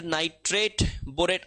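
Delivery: ordinary talk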